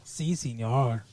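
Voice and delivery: slow, low, soft Mexican-accent attempt; mock Mexican accent. Shown as a demonstration. Showing off a Mexican accent to co-host, hammed-up. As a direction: put on a cartoonish Mexican accent, slowly. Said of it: "I can do a Mexican accent".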